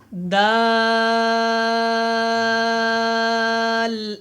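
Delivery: دال stretched very long, slowly; drawn-out daal. Shown as a demonstration. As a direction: hold the vowel of دال long. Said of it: "same جیم کی طرح اس کو میں نے لمبا کیا". (دال کو لمبا کھینچ کر پڑھیں)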